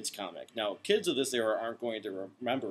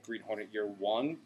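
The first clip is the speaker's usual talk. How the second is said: normal talking voice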